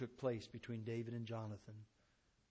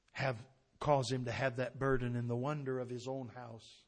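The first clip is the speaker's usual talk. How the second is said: ordinary talk, nothing marked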